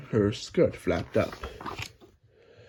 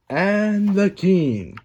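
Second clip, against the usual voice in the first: high, slow, louder proclamation; herald announcement; 7 semitones higher, 4 dB louder, much slower than usual. Acted. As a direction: announce the King to the court.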